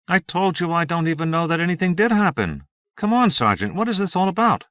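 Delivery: high-pitched, insistent denial; indignant suspect, voice riding high; exasperated protest. Acted. Suspect protesting to the interrogating sergeant.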